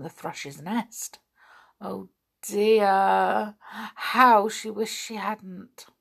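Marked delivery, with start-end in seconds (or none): none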